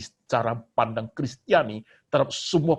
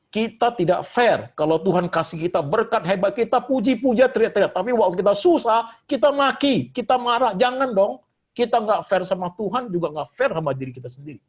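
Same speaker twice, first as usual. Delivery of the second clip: higher and louder, pounding the pulpit with each clause; fiery preaching; 8 semitones higher, 8 dB louder than usual. Acted. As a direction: preach it full force at the congregation. Preaching at full force.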